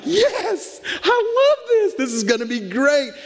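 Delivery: high, laughing giddy glee; giddy high-pitched excitement, almost crying; excited. Retold or imitated. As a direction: squeal with delight at what's coming. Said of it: "I get excited at those moments".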